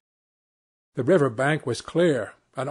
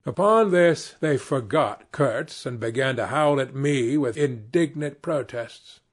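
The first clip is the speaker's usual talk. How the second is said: usual speaking voice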